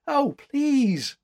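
high, loud pleading protest; crying out. A narrator's reading. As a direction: cry it out imploringly. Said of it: "cried the girl".

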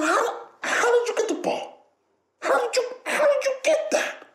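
very high, squeaky mock outrage; shrieky pretend-baffled pet talk; fake mad. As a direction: playfully accuse the dog in falsetto. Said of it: "fake mad".

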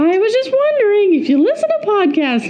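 high-pitched pop-singer impression; high, swooping put-on voice. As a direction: pitch it way up like a young pop star.

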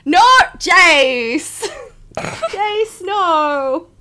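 high, loud drawn-out wail of no; scandalised, pleading cry at a friend; outraged protest. Real, not acted. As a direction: wail it in laughing dismay. Mock protest at friend's teasing.

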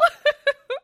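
high, loud laugh breaking toward a sob; laugh-cry. Real, not acted. Telling listeners about her sick cat, crying laugh. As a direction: laugh so you don't cry. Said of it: "laughing because if I wasn't, I'd be crying".

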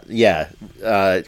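normal talking voice

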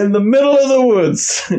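high-pitched comic incredulity; mock outrage. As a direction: pile on the disbelief. Mock outrage at plot hole.

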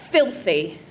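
ordinary talk, nothing marked

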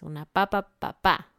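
"papa" stressed first, then "papá" stressed last; papa versus papá. Shown as a demonstration. Teacher contrasts "papa" and "papá" for students. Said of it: "Hay una gran diferencia".

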